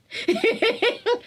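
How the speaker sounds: a high, bright burst of giggles. Real, not acted. Giggling after joking about bathtime thoughts. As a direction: giggle at your own joke. Joke about being alone.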